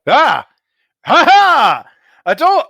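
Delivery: high, loud booming creature cry; grotesque monster voice. Acted. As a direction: bellow it out like a delighted monster. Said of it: "you hear a deep voice go".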